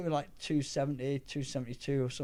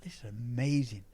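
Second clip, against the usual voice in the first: awed thought; 3 dB quieter than usual. Retold or imitated. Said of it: "and I'm thinking".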